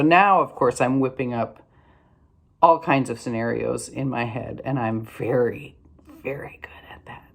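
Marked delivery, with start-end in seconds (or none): 6.2-7.2 a dry, whispered joke to herself; whispered aside. Real, not acted. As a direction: drop to a whisper for the punchline. Wry self-deprecating aside.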